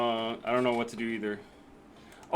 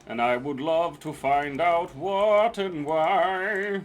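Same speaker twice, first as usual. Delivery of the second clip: slow, drawn-out, higher exasperation; exaggerated exasperation; 4 semitones higher, 5 dB louder, much slower than usual. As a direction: drag it out, fed up. Exasperated at broken stream.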